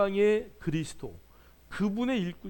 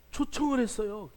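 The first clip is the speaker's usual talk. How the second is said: ordinary delivery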